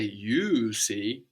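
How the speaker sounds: YOU leaned on and drawn out before "see"; stress on YOU. Shown as a demonstration. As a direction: put the emphasis on YOU. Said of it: "and we emphasize you".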